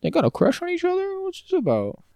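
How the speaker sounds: high put-on giddy voice; teasing gossip voice. Friend mocking the budding romance, high giddy voice. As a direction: squeal it like a gossiping fan.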